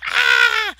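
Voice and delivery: sudden high, loud yelp; real startled cry; startled shriek. Real, not acted. Builder startled mid-sentence, sudden shriek.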